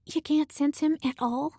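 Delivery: sudden high blurted question. Acted. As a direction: let it slip out, high and sudden. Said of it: "Ember blurted".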